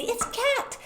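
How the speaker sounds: put-on high, quick child answer; child's eager high answer; cheetah answering fast. Shown as a demonstration. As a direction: play the quick cheetah guessing. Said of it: "they'd be the cheetah, they say".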